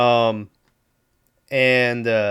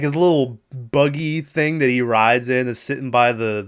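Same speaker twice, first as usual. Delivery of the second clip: ordinary delivery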